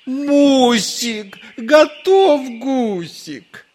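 very high, loud, slow wail; hungry husband howling for dinner; wailing yell. A narrator's reading. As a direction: bellow it out, unable to hold back. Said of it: "завопил".

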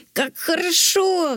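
mock dragon roar, high and voiced apart. Zok roars as he flies off with the princess. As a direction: roar it out with joy. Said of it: "взревел дракон".

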